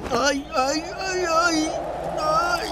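pained moaning. Acted. Groaning after being force-fed.

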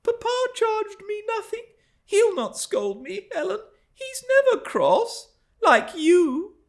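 put-on high, petulant girl's voice; sulky young girl. A narrator's reading. Girl protesting to her nurse, petulant. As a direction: play a hurt girl protesting.

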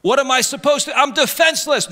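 high-pitched helpless plea; imitating a defenseless believer; mock helplessness. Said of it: "they don't know what to do".

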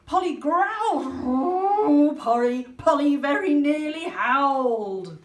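loud, slow growl building to a howl. A narrator's reading. Reader to children, growling loud and slow. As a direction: growl it out, then nearly howl.

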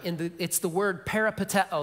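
ordinary talk

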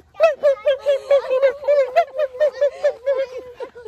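high squealing fox cry imitation; fox sound. Shown as a demonstration. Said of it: "That's the sound the fox makes".

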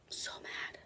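barely audible whisper; whispered annoyance. Real, not acted. Venting to viewers about keys left in his car, whispered. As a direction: whisper it under your breath.

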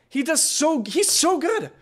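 high, delighted cheering; gushing praise. Real, not acted. Cheering on Breton boy after his verse, high and gushing. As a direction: squeal your praise at him.

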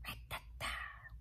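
hushed, slow rat-a-tat; barely audible, whispered tapping sound; mysterious tapping. A narrator's reading. Mysterious tapping noise heard again.

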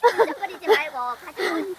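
high, loud scolding; nagging. Real, not acted. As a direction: lecture everyone about litter. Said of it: "경상도 아줌마의 잔소리 타임이었습니다".

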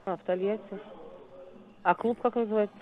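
ordinary talk

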